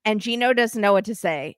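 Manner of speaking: ordinary talk, nothing marked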